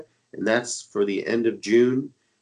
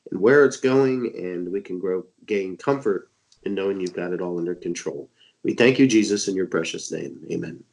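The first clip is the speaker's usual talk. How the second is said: prayer; 4 semitones lower than usual. Real, not acted. Closing prayer on radio show.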